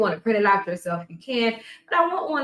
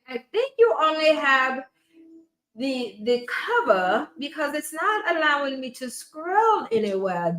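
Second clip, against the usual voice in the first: high, put-on British-African accent; a wobbly mixed British-African accent that keeps slipping; Professor Todd accent; 3 semitones higher than usual. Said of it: "that little British, African, whatever type of con-, accent she has".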